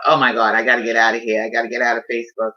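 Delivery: lightly voiced panicked resolve to quit Facebook; panicked thought. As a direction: rush it like you need to escape. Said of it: "and I thought".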